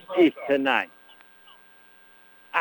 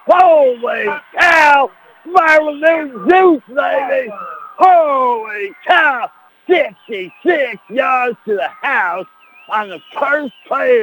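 much higher, much louder, slow stretched yells; shouting touchdown call; 11 semitones higher, 5 dB louder than usual. Acted. Cousin Clyde calling the touchdown for the listeners, shouting high and slow. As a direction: call the touchdown at full roar.

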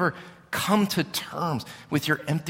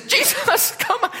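much higher, laughing mock panic; Peter freaking out; slower than usual. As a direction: play Peter squeaking in alarm. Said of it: "freaks out".

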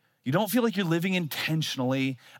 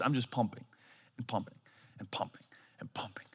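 trailing off from quiet to a breathy whisper; fading to whisper; 4 semitones lower, 10 dB quieter than usual. Retold or imitated. Exhausted from pumping in the rain, trailing to a whisper. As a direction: let each pumping fade away.

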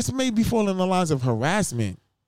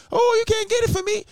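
much higher teasing voice; high mocking taunt. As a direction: squeal it like a taunting kid.

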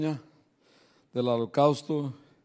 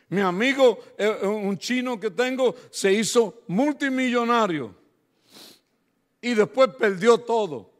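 raised, fast, higher preaching voice; 8 semitones higher, 7 dB louder than usual. Acted. Pastor telling the church a story, preaching it out. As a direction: drive the story home to the church.

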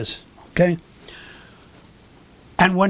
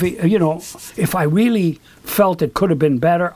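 normal talking voice

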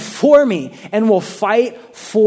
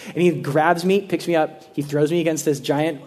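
usual speaking voice

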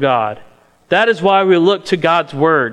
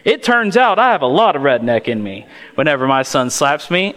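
usual speaking voice

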